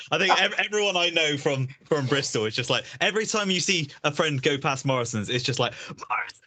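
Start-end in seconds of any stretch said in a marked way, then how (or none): 5.9-6.4 hushed, voiceless imitation of a scream; mock scream. Imitating friends passing the supermarket, hushed mock scream. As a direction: mimic the chant under your breath. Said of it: "screaming incomprehensibly at it".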